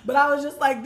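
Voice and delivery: ordinary talk, nothing marked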